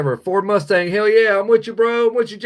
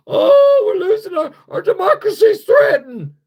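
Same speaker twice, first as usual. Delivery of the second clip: very high, louder mock wail with a fake sob; hammed-up falsetto lament; mock whiny Democrat; 10 dB louder than usual. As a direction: parody a politician crying over democracy. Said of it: "you sound like Joe Biden now".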